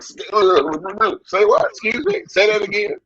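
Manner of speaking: high, incredulous double take; can't believe what he's hearing; disbelieving surprise. Retold or imitated. Retelling the call from the booker, in stunned disbelief. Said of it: "I'm going like".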